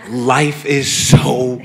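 loud, high, drawn-out elation; overjoyed, stretched-out holler; exultant shout. Real, not acted. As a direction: shout it slowly, savoring every word. Exulting over small pleasures.

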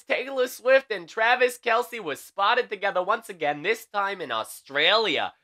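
usual speaking voice

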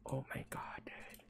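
breathless hushed whisper. Real, not acted. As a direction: whisper so nothing hears. Creeped out, hushed.

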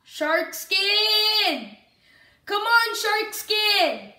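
high, drawn-out calling; pleading call. Real, not acted. Gamer calling to shark skin who may die, pleading call. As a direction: urge on a teammate from afar, pitched high. Calls to teammate about to die.

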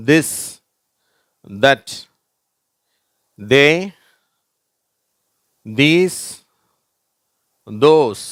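This, that, They, these, those singly, long pauses between, voiced dh; repeat-after-me reading. Shown as a demonstration. Teacher has pupils repeat along, repeat-after-me reading. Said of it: "சொல்ல, சொல்ல சொல்லுங்க".

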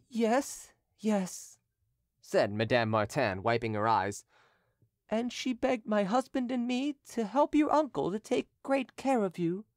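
lightly played grieving friend; lightly voiced Madame Martin, tearful. A narrator's reading. Madame Martin comforting the youth.

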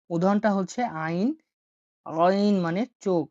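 usual speaking voice

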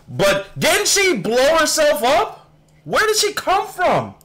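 high-pitched, slow incredulous questions; baffled surprise. Real, not acted. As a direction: stretch the questions out high, completely puzzled. Puzzled by character's return in clip.

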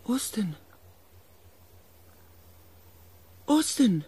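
insistent calling. Acted. Narrator calls after Austin's fading voice, building urgent call. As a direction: call the name, then call it harder.

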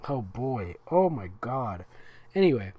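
ordinary delivery

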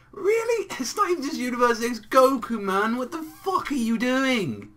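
high-pitched incredulous rant; exasperated. Real, not acted. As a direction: tell him off in high, exasperated disbelief.

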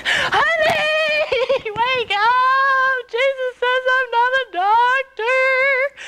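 high, drawn-out tearful cry; mock distraught wail. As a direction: wail to wake your husband, hammed-up. Wakes husband in distress, acted.

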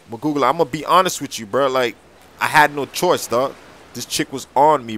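normal talking voice

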